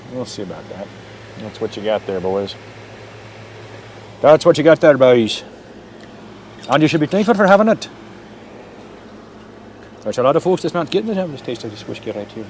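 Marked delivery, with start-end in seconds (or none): 4.2-5.4 bad Irish accent. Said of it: "that's a horrible Irish accent". 6.7-12.5 quick, hammed-up Irish brogue; bad Irish accent. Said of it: "that's a horrible Irish accent".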